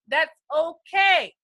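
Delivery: hearty called-out reassurance. Acted. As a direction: boom it out to calm them. Reassuring confused newcomers.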